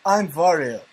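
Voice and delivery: mock Russian accent; hammy Russian accent, a bit like Bela Lugosi. Shown as a demonstration. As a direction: put on a rough attempt at a Russian accent. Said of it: "let me do it in a Russian accent".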